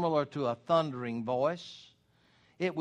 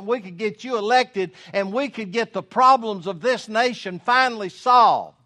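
higher and louder, pushing the idea eagerly; eager enthusiasm; 5 semitones higher, 6 dB louder than usual. Acted. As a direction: sell Jesus on running for president. Peter eagerly proposing to Jesus.

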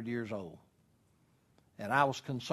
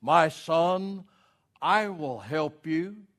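slow, solemn voice of God; thunderous voice from heaven; 4 semitones higher, 4 dB louder, much slower than usual. Retold or imitated. God answers the man on the cliff, slow mock thunder. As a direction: boom it out slowly, word by word. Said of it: "a loud voice, just almost like a thunder".

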